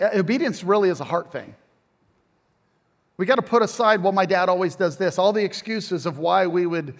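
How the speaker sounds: normal talking voice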